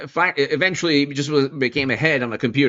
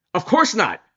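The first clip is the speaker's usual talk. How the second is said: emphatic dismissal; 7 semitones higher than usual. Real, not acted. Answers own rhetorical question emphatically.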